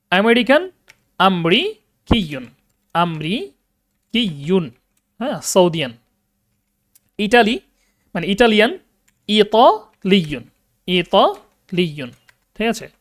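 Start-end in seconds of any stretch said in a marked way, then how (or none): 1.2-4.7 আমরিকিয়ুন very slow, broken by pauses; syllable-by-syllable demonstration. Shown as a demonstration. Teacher modelling for students, slow broken syllables. 9.3-12.1 ইতালিয়ুন very slow, split after "ita"; syllable-by-syllable demonstration. Shown as a demonstration. Teacher modelling for students, slow split syllables. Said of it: "এভাবে ব্যবহৃত হবে".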